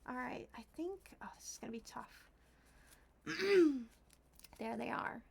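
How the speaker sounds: breathy whispered aside. Real, not acted. Whispering to herself while cutting felt. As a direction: whisper it to yourself.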